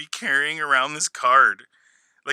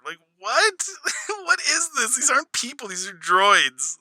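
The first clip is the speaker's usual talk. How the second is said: ordinary talk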